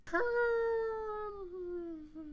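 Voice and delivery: high strained dying cry; dying villager wail. Acted. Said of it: "Welcome to the sound of a dying villager".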